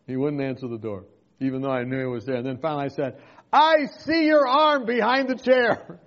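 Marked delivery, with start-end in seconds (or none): 3.5-6.0 loud, slow call through the window. Retold or imitated. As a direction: call out so he hears you behind the chair. Calling to man hiding inside.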